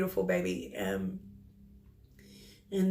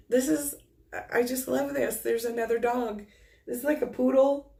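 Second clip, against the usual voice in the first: delighted, excited; 6 semitones higher, 4 dB louder than usual. Real, not acted. Medium delighted by a new dog.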